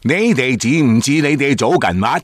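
put-on high, foreign-accented Cantonese; 老外说粤语. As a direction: play a foreigner speaking Cantonese. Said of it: "就是老外说广东话那种". (老外口音的粤语)

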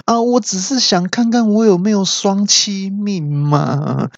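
high, slow, stretched whine; whining back-talk. Acted. Beaten husband talking back to his wife, whining. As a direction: plead an excuse in mock protest. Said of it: "还回嘴说".

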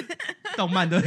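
loud high laughter around the words; cracking up at her own joke; laughing. Real, not acted. 笑着说像动漫. (大笑着说)

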